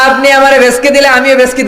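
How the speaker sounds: high, loud sermon warning; fiery preaching cry; thundering preacher. Acted. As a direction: boom the warning out. Preaching at full force.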